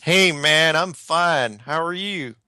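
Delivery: a hint of a put-on American accent; mock American accent. Host hamming up an American accent for an Australian caller. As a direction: playfully exaggerate your own American. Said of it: "You can't, you can't do an American accent".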